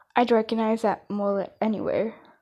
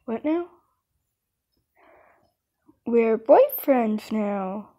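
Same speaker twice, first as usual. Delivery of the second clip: ordinary delivery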